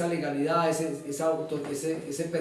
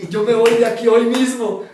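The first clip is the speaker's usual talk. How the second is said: loud, higher retold declaration; emphatic announcement; 4 semitones higher, 11 dB louder than usual. Prisoner telling jailed lawyer he is free, loudly emphatic. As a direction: proclaim it with full conviction. Retelling defiant announcement of his release.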